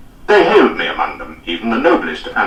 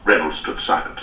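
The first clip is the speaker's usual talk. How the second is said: usual speaking voice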